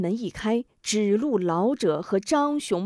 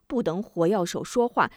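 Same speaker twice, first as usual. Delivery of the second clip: ordinary delivery, nothing marked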